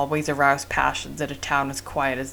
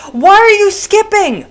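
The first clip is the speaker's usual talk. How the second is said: high, loud and drawn out; shocked that the dialogue skipped; surprised; 13 dB louder, slower than usual. Real, not acted. Dialogue skips without warning.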